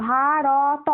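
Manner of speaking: ভারত drawn out as three open sounds, ভা-র-ত; wrong letter-by-letter split. Shown as a demonstration. Teacher warns pupils with a wrong example, drawn out letter by letter. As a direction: stretch ভারত slowly, voicing র and ত each with its own vowel. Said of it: "এরকম করে উচ্চারণ করা যাবে না".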